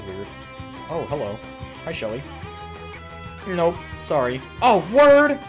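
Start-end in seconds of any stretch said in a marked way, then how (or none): 4.6-5.4 high, loud burst of surprise; surprised exclamation. Real, not acted. Streamer surprised mid-match, loud exclamation. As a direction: exclaim it loud, pitch soaring.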